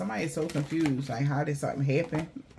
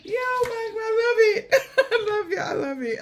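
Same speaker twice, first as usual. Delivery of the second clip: much higher, laughing and crying with joy; overjoyed laugh-cry; 4 dB louder than usual. Real, not acted. Shouting love to Mike, laughing and crying with joy. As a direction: gush out thanks through laughing tears.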